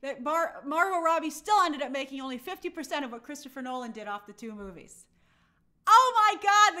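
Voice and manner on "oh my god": loud exclamation. Real, not acted.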